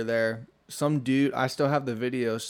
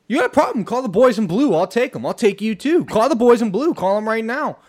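high, loud, threatening rant; belligerent yelling; 10 semitones higher, 10 dB louder than usual. Retold or imitated. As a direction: act out a crazed man daring them to call cops. Aggressive stranger quoted.

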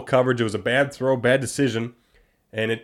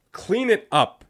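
high, emphatic demand; exasperated scolding; 8 semitones higher, much slower than usual. Real, not acted. Host scolding the team after a penalty, exasperated demand. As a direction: pitch it up in exasperation.

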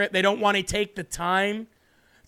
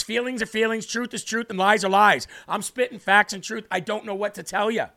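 normal talking voice

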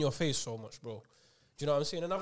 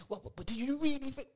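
pushy preacher; 9 semitones higher than usual. Retold or imitated. Preacher voiced in story.

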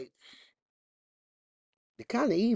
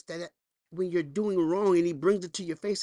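ordinary talk

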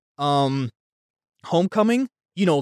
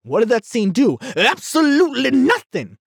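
heated rant; 6 semitones higher than usual. Real, not acted. Vlogger venting about the pointless scene, heated and high. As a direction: vent real frustration at the scene. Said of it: "getting heated".

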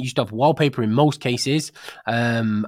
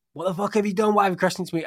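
mock angry outburst; 7 semitones higher, faster than usual. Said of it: "I never came downstairs and said".

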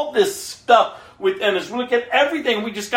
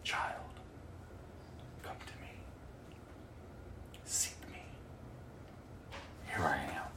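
still small whisper; 27 dB quieter than usual. Acted. God whispering to his child amid the noise, soft whisper. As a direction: whisper it like a gentle call in the dark. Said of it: "there's a still small whisper".

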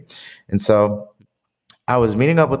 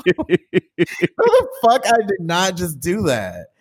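laughing; 6 semitones higher, slower than usual. Real, not acted. Laughing at own fall.

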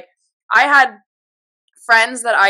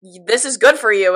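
louder blunt disbelief; blunt retort; 2 semitones higher, 7 dB louder than usual. Retold or imitated. Friend bluntly questioning her tears, louder. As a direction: snap her out of it, loud.

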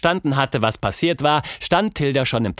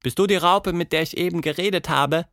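put-on curious girl; 8 semitones higher than usual. Tilda asks butterfly.

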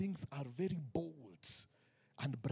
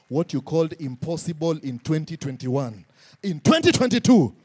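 shouted build; 14 dB louder than usual. Real, not acted. Preacher repeating the line to the church, now shouting. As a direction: push it up to a shout. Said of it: "do I have a church in this place?".

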